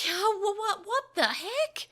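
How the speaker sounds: high, slow, stammering shock; startled. A narrator's reading. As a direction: stammer in alarm. Boy startled by creature.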